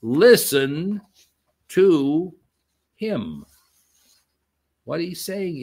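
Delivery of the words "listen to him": each word set apart like a decree; voice from heaven. Acted. God commanding the disciples from the cloud, slow and weighty.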